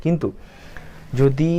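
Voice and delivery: normal talking voice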